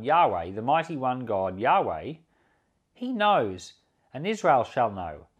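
usual speaking voice

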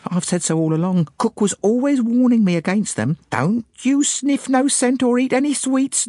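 high-pitched maid gushing with lurid conviction; excited maid. A narrator's reading. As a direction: pour out your theory, thrilled and scandalised. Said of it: "fairly flowed into excited speech".

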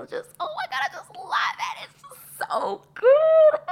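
squealing high whisper rising into a joyful crying shout; bursting with delight she can't hold in; overjoyed outburst. Real, not acted. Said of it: "Okay, I'm gonna calm down".

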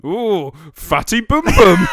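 sorting hat voice. Acted. Co-host plays the sorting hat on his mate, loud slow mocking hoot. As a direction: boom it out slowly as a mocking hat. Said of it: "It'd go".

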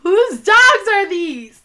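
high, slow, hammed-up disbelief; mock shocked gasp. Co-host playing up the mystery of the strays, mock shocked. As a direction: stretch it out like a soap opera gasp. Listener's mock dramatic question.

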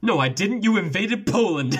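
high, laughing comeback; laughing retort. Retold or imitated. Host snapping back at the German, high and laughing. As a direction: crack up as you deliver it. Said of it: "And he was like".

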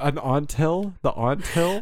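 "aunt hill" said as "ahnt hill"; questioning "aunt hill" with an open "ah" in "aunt"; broad-a aunt. Shown as a demonstration. Teasing the pronunciation debate, "aunt hill" said as "ahnt hill". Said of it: "Do you say aunt or".

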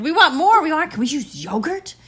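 put-on high, eager kid voice; begging kids.

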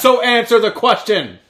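loud, high and insistent; demanding shout. Real, not acted. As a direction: push him to answer now. Pressing co-host for an answer.

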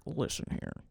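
low, mildly annoyed imitation; annoyed Brad. Imitating Brad annoyed by the phone, low and irritated. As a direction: drop low for a mock warning. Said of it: "Brad is annoyed. Brad's like".

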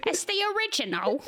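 hammed-up British film reviewer; British accent. Finishing the review line for laughs, British accent. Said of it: "in a British accent".

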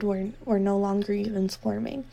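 ordinary delivery, nothing marked

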